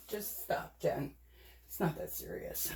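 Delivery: hushed aside to herself; calm yourself down in a whisper; whispered self-scolding. Real, not acted. Scolding herself under breath.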